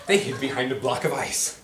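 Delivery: ordinary delivery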